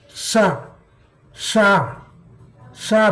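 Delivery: Sha said slowly from the palate; sha demo. Shown as a demonstration. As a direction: sound out Sha three times. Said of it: "Pronounce the sha".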